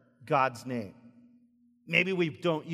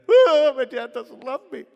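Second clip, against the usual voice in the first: much higher, louder, hammed-up wounded kid; mock whiny child; 8 semitones higher, 12 dB louder than usual. Child complaining to dad, hammed-up whine. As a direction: wail it like a sulking child, half laughing. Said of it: "they're like".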